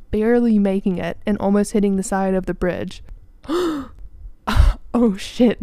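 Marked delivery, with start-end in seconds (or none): 3.4-4.9 a sudden high gasp of fright; sharp frightened gasp. Retold or imitated. Passenger nearly crashing into the bridge, sharp high gasp. 4.9-5.6 jolted, louder oh shit; startled oh shit. Retold or imitated. Passenger just after a near crash, louder startled blurt.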